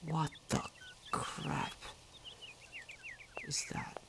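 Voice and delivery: breathless spooked whisper. Real, not acted. Player creeped out by a sound, whispering. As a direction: hush it like something might hear.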